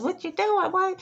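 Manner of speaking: high, sharp mock yell at mom; a hint of a fed-up caregiver shouting; mock hollering. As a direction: pretend to holler at her in the night. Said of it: "hollering at her".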